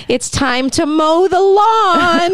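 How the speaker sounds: put-on high, slow, singsong little-boy voice; creepy child voice. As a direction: drag it out like a creepy child killer. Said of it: "he kinda talks like this".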